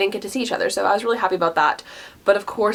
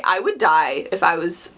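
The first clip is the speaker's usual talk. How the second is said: usual speaking voice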